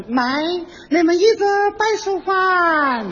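high, very slow mock English phrase. Shown as a demonstration. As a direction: drawl it out like a stage foreigner. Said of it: "你说".

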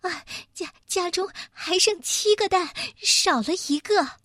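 high-pitched, stammering mother hen; flustered stammer. A narrator's reading. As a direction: stumble over the words in alarm. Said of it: "她结结巴巴地说".

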